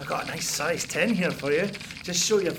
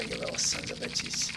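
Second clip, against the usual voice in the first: whisper; 7 dB quieter than usual. Acted. Muttering at found rats.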